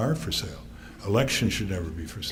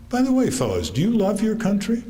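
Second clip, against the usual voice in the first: much higher, louder pointed question; spring the question on them; father needling the customers, playful and pointed; father's challenge; 11 semitones higher, 4 dB louder than usual. Retold or imitated. Father turning tables on customers.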